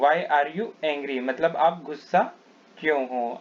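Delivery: usual speaking voice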